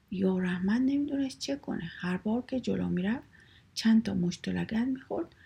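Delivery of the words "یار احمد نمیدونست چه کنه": plain reading voice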